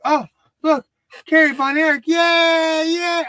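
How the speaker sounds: put-on high, loud kid cheering; childhood excitement. Grown fan replaying his childhood cheer at the TV. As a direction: squeal like a kid seeing his hero. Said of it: "I remember being excited".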